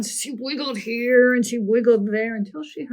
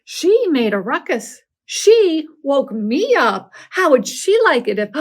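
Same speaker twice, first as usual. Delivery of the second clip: loud, higher, hurt protest; indignant bear; 5 semitones higher, 10 dB louder than usual. Acted. Bear protesting that the girl woke him, indignant. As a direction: bear huffing in outrage. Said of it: "Bear was indignant".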